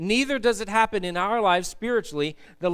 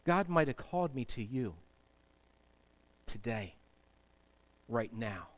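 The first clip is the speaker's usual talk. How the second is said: quiet prayer; 9 semitones lower, 10 dB quieter than usual. Retold or imitated.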